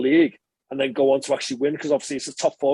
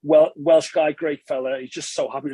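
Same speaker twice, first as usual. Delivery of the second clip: usual speaking voice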